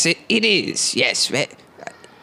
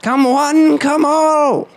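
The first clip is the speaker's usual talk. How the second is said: carnival barker call; 7 semitones higher, much slower than usual. Acted. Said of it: "unwashed preacher".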